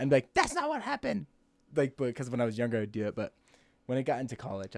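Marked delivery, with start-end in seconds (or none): 0.3-1.2 high-pitched mock outburst, pushed hard; mock protest. Friend butting in to correct someone's story, high mock protest. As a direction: cry out in exaggerated objection.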